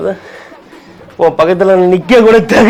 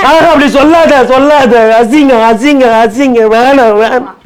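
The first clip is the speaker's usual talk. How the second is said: higher, wailing sob of refusal; mock sobbing; 6 semitones higher than usual. Husband sobbing as his wife says she likes him, in a hammed-up wail.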